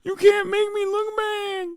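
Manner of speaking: high, weepy mocking whine; mock crying crybaby host; whiny crybaby. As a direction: whimper it like a hurt child. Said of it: "what I hear is".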